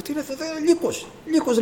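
high-pitched retold cry of alarm. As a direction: relive spotting a wolf among the flock, voice leaping high. Alarmed at wolf in dream.

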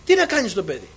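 high, agitated re-enacted panic; retold frantic shouting. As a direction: act out frantic shouting for help. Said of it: "και εκεί φώναζε".

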